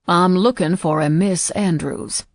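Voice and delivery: put-on slow, polite Southern accent; Southern gentleman asking, slowly; proper Southern accent. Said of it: "he said in a proper Southern accent".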